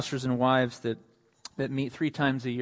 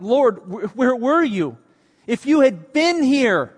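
high, loud, hurt reproach; Martha reproaching; 9 semitones higher, 8 dB louder than usual. Acted. Martha questioning Jesus about her brother, in a high, loud, hurt reproach. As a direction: demand to know where he was.

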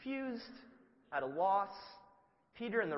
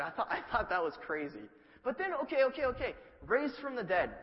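ordinary talk

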